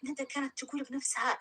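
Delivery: ordinary delivery, nothing marked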